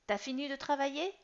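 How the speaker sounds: put-on a cheeky boy checking on dad; teen son voice. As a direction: son interrupting his father at work. Son questions busy father.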